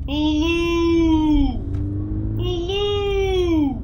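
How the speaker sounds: high, drawn-out eerie call from the roadside; hailing call. Acted. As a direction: stretch each hello out like a ghostly hail. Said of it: "And he hailed me".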